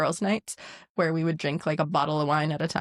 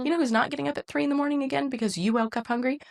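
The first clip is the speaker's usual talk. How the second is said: exasperated mom; 6 semitones higher, faster than usual. Retold or imitated. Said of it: "I was like".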